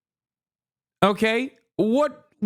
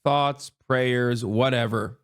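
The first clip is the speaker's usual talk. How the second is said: dismissive shrug; 9 semitones lower, slower than usual. Retold or imitated. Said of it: "everybody goes".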